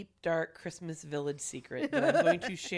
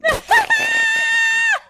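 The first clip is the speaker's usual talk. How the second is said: loud, high shrieking laugh; 14 dB louder than usual. Real, not acted. Friend shrieks with laughter at confession, loud and high. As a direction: burst into a piercing shriek of laughter. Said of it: "That had to hurt your ears".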